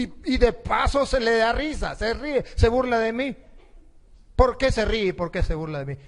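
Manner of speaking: mock outraged accusation; offended princess. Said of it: "Se puso brava".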